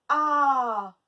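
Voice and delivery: A loud and held long; stretched vowel. Shown as a demonstration. Teacher letting pupils hear the A, stretched vowel. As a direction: sustain A as a strong open vowel. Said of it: "on l'entend".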